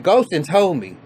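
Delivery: a retold loud reveal; mock blurt. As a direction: blurt out the source. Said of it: "she said".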